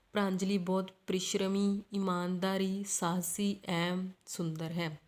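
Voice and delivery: brief stops after परिश्रमी and ईमानदारी, slowing towards सुंदर है; short comma pauses. Shown as a demonstration. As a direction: pause lightly after each quality, परिश्रमी, ईमानदारी, साहसी. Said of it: "थोड़ा धीरे धीरे रुक रही हूँ". (परिश्रमी, ईमानदारी, साहसी पर थोड़ा-थोड़ा रुकना)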